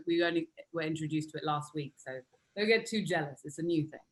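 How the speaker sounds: ordinary talk, nothing marked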